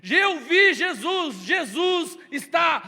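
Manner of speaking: loud, slow joyful proclamation; triumphant shout. Acted. Mary telling the disciples, triumphant shout. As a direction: shout the news slowly, full of joy.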